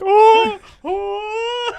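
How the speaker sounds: high cry while falling; hammed-up falling teacher; mock falling cry. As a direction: wail as you topple over. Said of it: "she was like".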